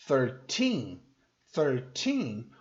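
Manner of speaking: put-on thirteen slow, long stressed TEEN. Shown as a demonstration. Teacher contrasting with thirty, thirteen stressed on TEEN. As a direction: lean on the end of thirteen. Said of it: "o foco fica no final".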